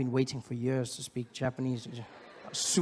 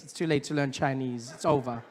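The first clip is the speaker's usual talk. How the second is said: ordinary delivery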